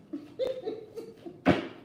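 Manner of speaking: breathy laugh rising high; airy giggle. Real, not acted. Host laughing while shuffling cards.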